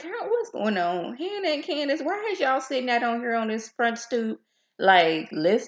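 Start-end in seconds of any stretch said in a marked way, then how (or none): 0.0-4.4 pitched way up, puzzled; what's going on, high and confused; baffled high outburst. Retold or imitated. Vlogger questioning Hannah and Candace, high and baffled. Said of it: "I'm like".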